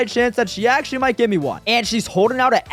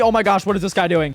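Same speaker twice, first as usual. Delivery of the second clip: ordinary talk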